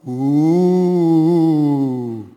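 a silly spooky ghost moan; mock ghost ooh. Jesus pretends to spook the frightened disciples, silly ghostly ooh. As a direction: tease them with a playful spooky ooh. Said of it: "or some other silliness".

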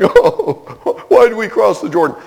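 high, laughing mock lament; mock wailing. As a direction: wail it while half laughing at Joshua. Preacher mocking Joshua's despair.

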